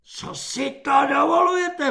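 high loud shriek. A narrator's reading. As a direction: shriek it in outrage. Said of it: "vřískal svobodník".

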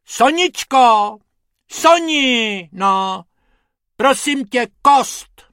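high, loud, drawn call. A narrator's reading. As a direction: shout for the bone. Hubert calls to assistant.

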